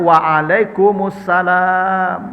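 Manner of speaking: waalaikumsalam drawn out slowly; patient teaching reply; slow model reply. Shown as a demonstration. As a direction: model waalaikumsalam at half pace.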